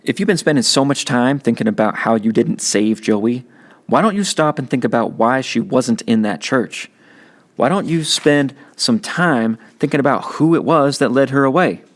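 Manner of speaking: big brother setting him straight; teen big brother. Acted. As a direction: talk down to your kid brother, tough but caring. Byron lecturing his little brother.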